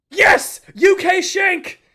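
loud, elated cheer, Shank drawn out slowly; triumphant gamer yelling at his screen over a rare drop; excited shout. Real, not acted. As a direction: yell it like you finally won. Wins the skin he wanted.

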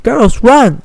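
loud, high warning cry; urgent shout. Acted. Twilight warning the fillies to flee the manticore, frantic shout. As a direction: yell at them to run.

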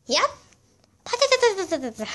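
power up scream. Pretend. Transformation power-up.

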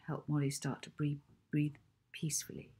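ordinary delivery

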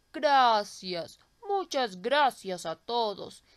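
a tree thanking its rescuers, slowly; grateful old tree. Acted. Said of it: "exclamó el árbol".

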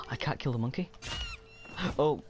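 normal talking voice

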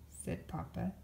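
plain reading voice

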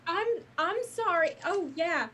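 lightly voiced Southern Belle apology; Southern Belle accent, slipped in by accident. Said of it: "it's a little bit Southern Belle".

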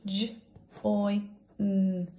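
each sound said apart, slow and separate; segmented phonics sounds; sounding out letters. Shown as a demonstration. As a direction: break the word into its sounds. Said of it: "So let's sound it out".

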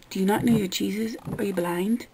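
ordinary talk

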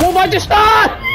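very high, rising disbelief; astonished squeal. Real, not acted. Gamer amazed at what just happened, astonished squeal. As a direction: shriek the question in amazement.

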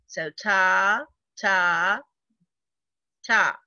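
"ta" chanted evenly on each beat; steady beat-by-beat "ta" reading; rhythm syllables. Shown as a demonstration. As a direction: tap out each "ta" like a quarter note. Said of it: "we don't say anything".

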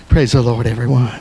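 low, subdued pastor voice; mild-mannered pastor. Pretend. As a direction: greet the flock in a deep, restrained tone. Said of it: "Preach like your pastor".

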